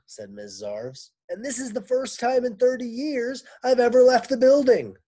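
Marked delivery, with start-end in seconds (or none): 1.3-5.0 much higher and louder, exasperated; high, loud teacher's lament. Acted. Miss Zarves telling the men, high loud lament. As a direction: cry out the years of work. Complaining teacher voiced in story.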